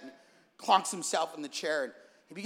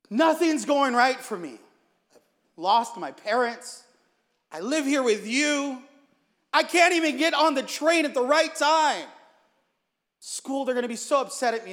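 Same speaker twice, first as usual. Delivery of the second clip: high, loud exasperated lament; frustrated; 7 semitones higher, 6 dB louder than usual. Acted. Grandson venting to his grandfather, frustrated. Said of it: "Grandson sits down frustrated".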